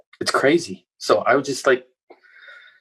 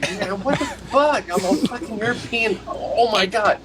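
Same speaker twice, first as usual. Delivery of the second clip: mock exasperation; 9 semitones higher than usual. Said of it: "I'm like".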